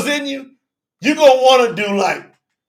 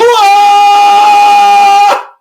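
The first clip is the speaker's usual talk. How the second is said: high, loud joyful laughter; 10 semitones higher, 9 dB louder than usual. Real, not acted. Preacher laughing with joy after the shout.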